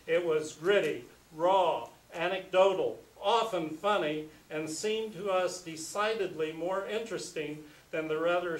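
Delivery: emphatic, declamatory reading from a text; reading aloud. Acted. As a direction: read it out with punched key words and rising-falling swells. Reads his introduction aloud.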